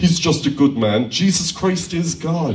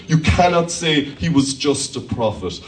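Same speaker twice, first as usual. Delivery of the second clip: ordinary talk, nothing marked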